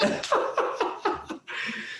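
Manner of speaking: breathy laughing fit; real laughter. Real, not acted. Host reading a funny viewer comment, laughing. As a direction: crack up at the comment. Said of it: "I just read it".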